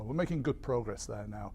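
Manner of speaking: ordinary talk, nothing marked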